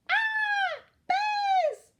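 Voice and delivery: very high, loud shriek of alarm; terrified scream. A narrator's reading. Goldilocks wakes to three bears, shrieking in fright. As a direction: scream it out at full pitch.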